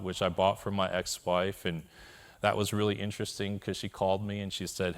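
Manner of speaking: ordinary talk, nothing marked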